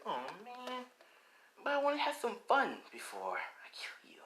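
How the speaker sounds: high, soft villain whine; mock-disappointed Gremlin. Gremlin wanting fun before killing Spider-Man, mock disappointed. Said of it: "The Grim was like".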